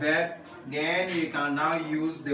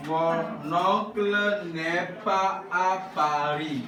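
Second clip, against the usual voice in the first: slow, stretched-out syllables; spaced out for repeating; slow model reading; slower than usual. Shown as a demonstration. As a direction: model it slowly for the class. Models French slowly for class to repeat.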